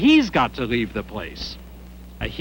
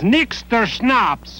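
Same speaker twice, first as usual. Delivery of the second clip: journalist mimicking a German priest's order, higher and drawn out; slow, higher mock-German pronouncement; little German priest; 8 semitones higher, much slower than usual. Journalist retelling a priest's rebuke to an innkeeper, lightly mimicked German priest. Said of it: "this little German priest".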